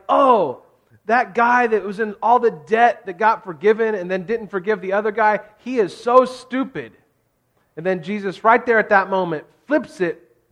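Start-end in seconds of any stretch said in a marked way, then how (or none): 0.1-6.9 louder and higher, self-righteous scorn; smug judgment. Retold or imitated. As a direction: sneer at the foolish servant. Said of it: "where you're like".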